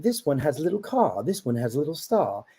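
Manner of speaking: put-on British accent for a picture book. Shown as a demonstration. Dad showing his Dr. Seuss voices on request, British accent. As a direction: read children's rhymes in a British accent. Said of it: "talk in a British accent then".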